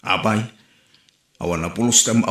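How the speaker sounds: usual speaking voice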